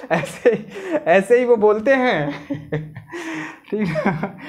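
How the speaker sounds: laughing at his own mock accent. Real, not acted. Teacher laughing at his own impression, amused. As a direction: chuckle through it, amused. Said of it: "laughs at own imitation".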